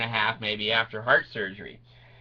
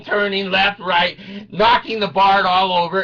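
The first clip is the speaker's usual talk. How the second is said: ordinary delivery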